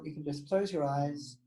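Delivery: slow, soft calming voice; guided meditation. Acted. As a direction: ease them into closing their eyes. Leads a meditation.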